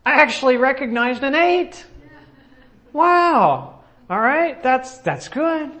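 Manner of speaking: high, delighted little prayer of thanks; grateful surprise. Retold or imitated. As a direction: replay a sudden happy thanks to God. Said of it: "I was like".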